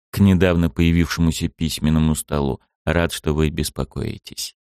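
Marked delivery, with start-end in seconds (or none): none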